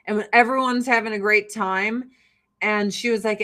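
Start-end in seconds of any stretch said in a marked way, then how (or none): none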